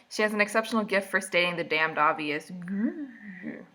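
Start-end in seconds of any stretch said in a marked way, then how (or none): 2.5-3.6 soft drawn-out grr; mock growl. Reader annoyed at stating the obvious, mock growl. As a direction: growl quietly in playful irritation.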